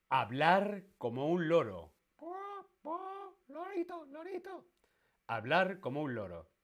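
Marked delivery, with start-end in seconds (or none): 2.2-4.7 very high squawky little parrot; cartoon parrot saying lorito. Shown as a demonstration. Imitating a parrot talking.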